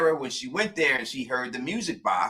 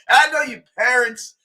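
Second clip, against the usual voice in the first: higher, louder callout to parents; calling out; 9 semitones higher, 7 dB louder than usual. Real, not acted. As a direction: shout it at the parents out there.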